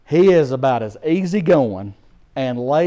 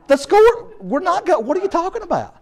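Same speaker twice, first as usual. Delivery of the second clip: much higher, squeaky indignant cry; hammed-up outrage, far higher; outraged protest; 13 semitones higher, 3 dB louder than usual. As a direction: sputter in disbelief. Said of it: "his own outburst at the game".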